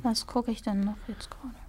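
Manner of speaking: whispered aside to herself; whispering. Real, not acted. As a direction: murmur the question under your breath. Muses to herself mid-chat. (leise geflüstert)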